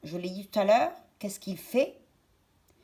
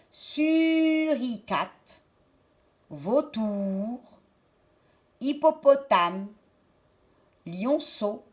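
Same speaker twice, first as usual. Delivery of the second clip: slow drawn-out word; much slower than usual. Shown as a demonstration. Teacher to children, stretching the word slowly. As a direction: draw out Suricate for the children. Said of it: "c'était un suricate".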